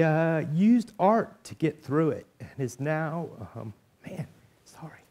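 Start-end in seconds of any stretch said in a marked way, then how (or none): 3.5-5.0 choked up. Real, not acted. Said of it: "I'm sorry".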